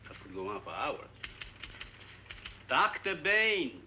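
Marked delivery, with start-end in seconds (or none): 2.7-3.7 slow, loud, higher-pitched summons; shouting. Acted. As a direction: shout the name to break through his concentration. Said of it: "There's no need to shout".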